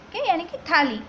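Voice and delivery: ordinary delivery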